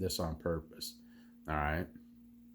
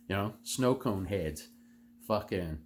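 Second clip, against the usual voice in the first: ordinary talk, nothing marked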